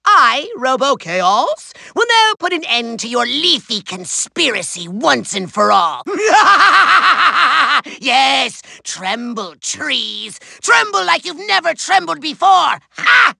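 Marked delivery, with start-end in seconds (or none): 6.1-7.9 long, pulsing villain cackle; maniacal evil laugh. Acted. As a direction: laugh like a triumphant madman. Villain laughs after threatening trees.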